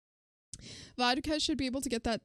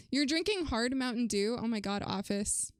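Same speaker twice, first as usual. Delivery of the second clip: ordinary talk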